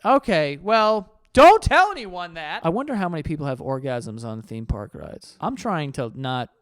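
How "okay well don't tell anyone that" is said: loud, high, scandalized warning; loud, raised-pitch disbelief; appalled reaction. Real, not acted. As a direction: blurt it out as urgent advice. Shocked reaction to embarrassing confession.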